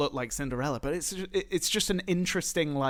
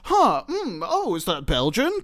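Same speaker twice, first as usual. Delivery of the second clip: mock puzzled Duke; 6 semitones higher, 7 dB louder than usual. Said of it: "And he's like".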